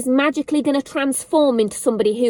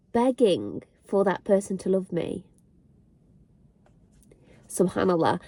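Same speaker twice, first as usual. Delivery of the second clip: holding back tears; 3 semitones lower than usual. Real, not acted. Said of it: "I can't fight these back anymore".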